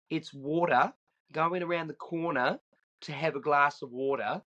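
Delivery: a hint of Australian, flap the T in "water" to a D; Australian accent. Shown as a demonstration. Cousin teaching his kid the Aussie way, lightly voiced Australian. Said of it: "say it in the Australian way, which is to put a D in there".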